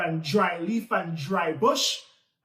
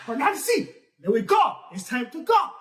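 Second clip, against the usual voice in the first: urgent call; 6 semitones higher, 4 dB louder than usual. Acted. Tukuma calling Nancy to leave.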